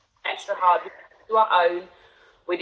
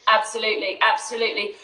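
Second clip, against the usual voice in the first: ordinary delivery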